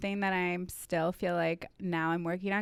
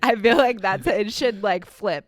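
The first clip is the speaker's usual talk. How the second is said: laughing; 4 semitones higher, 11 dB louder, slower than usual. Real, not acted. Laughing while explaining.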